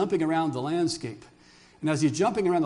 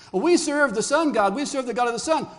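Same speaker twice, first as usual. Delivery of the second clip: higher, louder mock boast; acted boast; 6 semitones higher, 5 dB louder than usual. Pagan cultures telling Israel of their god, high loud boast. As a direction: proclaim it high and loud as a rival worshipper.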